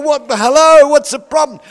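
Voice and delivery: fake-offended squeal at the table; high, loud mock protest; mock indignation.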